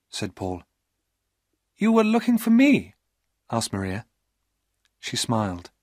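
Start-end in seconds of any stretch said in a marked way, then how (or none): 1.8-3.0 higher woman's voice, pleased and questioning; flattered young woman; coy high female voice; Maria's pleased voice. Acted.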